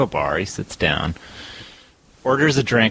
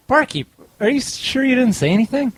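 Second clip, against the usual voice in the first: high, louder baffled question; rattled patron; 4 dB louder than usual. Retold or imitated. Drinker demanding the bartender admit speaking, high and rattled. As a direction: press the barkeep, getting unnerved.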